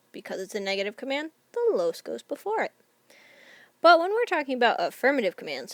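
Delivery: ordinary talk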